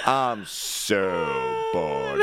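very slow, stretched so bored; drawn-out bored. Retold or imitated. Mocking the driver's text with a long drawn-out moan. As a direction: stretch every O as long as you can. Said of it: "giving him so much shit".

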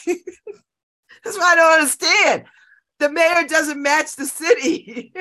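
high, slow cry through laughter; laughing exasperation. Real, not acted. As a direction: protest loudly while laughing.

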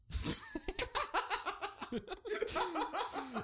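high, long burst of laughter; big laugh. Real, not acted. German speaker cracking up mid-explanation of letter sounds, big laugh.

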